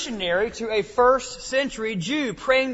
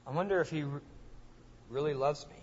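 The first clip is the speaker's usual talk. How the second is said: anxious quiet doubt; 5 semitones lower, 11 dB quieter, much faster than usual. Retold or imitated. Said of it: "After what I fell into last week".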